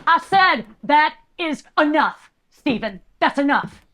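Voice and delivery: firm high-pitched reprimand; exasperated cutoff. Acted. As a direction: put your foot down, spacing each word. Sister cuts off unwanted talk.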